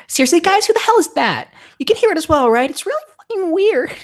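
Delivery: very high, loud put-on voice; freaked-out girl. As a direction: squeal in alarm at the group. Voices alarmed friend.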